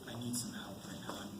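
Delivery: breathless frightened whisper; hushed plea for help; scared whisper. Acted. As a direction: whisper it, terrified. Scared man whispers to nun.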